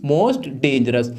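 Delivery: slow, stressed "Most" with a deep, drawn-out "dangerous"; stressed answer. Shown as a demonstration. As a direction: lean hard on "Most" and drag it out. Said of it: "क्या हो जाएगी?". ("Most" पर ज़ोर, बहुत धीमे)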